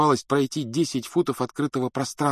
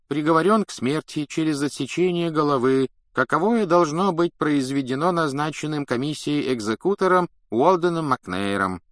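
raised-pitch formal droning; droning official read-out; 6 semitones higher than usual. A narrator's reading. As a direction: drone through an official sentence. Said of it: "монотонно бубнил Фадж".

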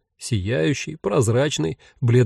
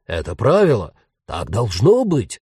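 exclaiming; 4 semitones lower than usual. A narrator's reading. Ghost insists Jen should fear the dead, indignant exclamation. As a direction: insist on the rule, swooping on each phrase. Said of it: "воскликнул призрак".

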